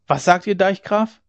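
the council members calling out to the dike reeve. A narrator's reading. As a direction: call out the question in surprise. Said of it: "riefen die Gevollmächtigten".

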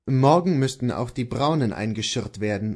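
plain reading voice

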